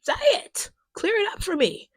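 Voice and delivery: high, loud yell at the movie; yelling at the screen. Retold or imitated. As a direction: urge the character on like an audience member shouting. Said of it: "This is me at the screen".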